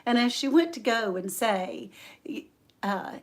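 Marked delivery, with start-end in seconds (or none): none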